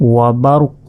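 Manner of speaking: ওয়া বারুক ending on a released, echoing q; বারুক closed with a bounced q; stopping pronunciation. Shown as a demonstration. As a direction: drop the -un and stop বারুক on q. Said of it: "যদি ওয়াকফ করি".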